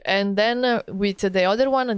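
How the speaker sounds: ordinary talk, nothing marked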